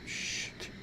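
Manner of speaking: very soft, voiceless mutter to self; real barely audible curse; whispered curse. Real, not acted. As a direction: swear under your breath. Curses to self between topics.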